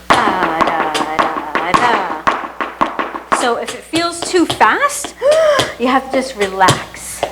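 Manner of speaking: loud rhythmic count calls over the footwork; counting out the beat. Shown as a demonstration. Teacher calling the beat to dancing students, loud count. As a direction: call the counts to keep the class on compás.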